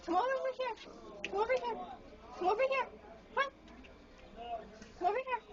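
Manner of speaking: high sing-song coaxing. Real, not acted. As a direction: call it over in a high, sweet voice. Coaxing bird to come over.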